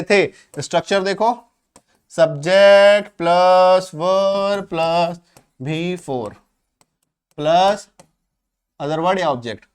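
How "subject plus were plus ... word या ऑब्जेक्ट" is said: each part set apart by pauses, very slow; slow dictation. Shown as a demonstration. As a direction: dictate the formula piece by piece. Teacher dictating the structure.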